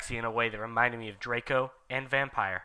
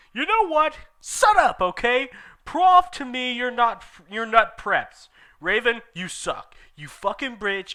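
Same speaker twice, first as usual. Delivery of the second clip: indignant rant; 9 semitones higher, 4 dB louder than usual. Acted.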